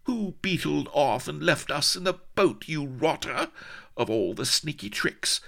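high, haughty tirade; affronted, high-voiced accusations; indignant scolding. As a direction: tell him off, pitched high. Indignant heroine scolds colonel.

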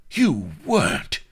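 high, loud exclamation of disbelief; exclaim, astounded; incredulous. A narrator's reading. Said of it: "exclaimed Vladimir incredulously".

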